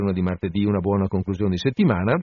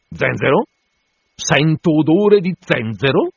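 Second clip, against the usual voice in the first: suspicious; 6 semitones higher, 6 dB louder than usual. A narrator's reading. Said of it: "Domanda Stubb, avvicinandosi sospettoso".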